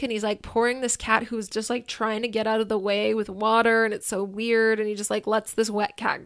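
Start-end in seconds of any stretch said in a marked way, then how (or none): none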